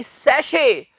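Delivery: "सैशे" with sh and no t; teacher models "सैशे"; correct "sachet". Shown as a demonstration. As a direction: repeat "सैशे" clearly, silent t. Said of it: "हाँ जी".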